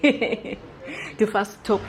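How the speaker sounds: words slowed and broken up by laughter; slow giggling delivery; laughing. Real, not acted. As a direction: laugh while recalling it, drawing the words out. Laughing through retold thought.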